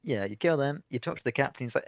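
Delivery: ordinary delivery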